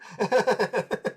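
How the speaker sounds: high, cackling giggle; tickled high laughter; hearty laugh. Real, not acted. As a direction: burst out in a long giggle. Laughing at the fork story.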